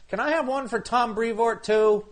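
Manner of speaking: high-pitched mocking voice; mock impression. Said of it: "he didn't sound like that".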